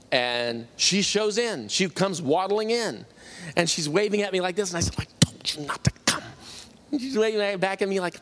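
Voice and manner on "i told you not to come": voiceless, urgent mouthed whisper; retold whispered warning. Son waves off his friend at the hospital, whispered. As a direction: act out whispering a warning across a room.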